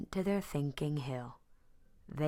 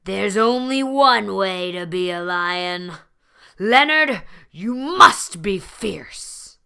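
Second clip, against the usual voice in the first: roaring lions; 4 semitones higher, 14 dB louder than usual. A narrator's reading. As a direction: bellow a command at Leonard. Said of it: "growled and roared".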